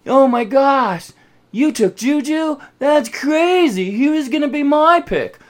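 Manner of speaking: high, hammed-up shock; fake surprise. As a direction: overact a rehearsed gasp of surprise. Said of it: "rehearsed their reactions".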